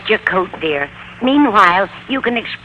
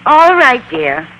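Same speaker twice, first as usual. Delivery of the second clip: calling back; 4 semitones higher, 5 dB louder, slower than usual. Acted. Landlady calling back upstairs.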